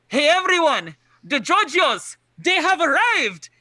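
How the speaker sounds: loud, high announcement to the whole camp; calling out. Acted. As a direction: holler the arrival excitedly, stretching the final words. Character calls out to whole camp.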